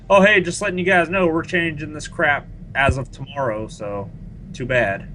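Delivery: high mock-casual announcement. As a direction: sneer through a fake breezy notice. Mocking BioWare's post.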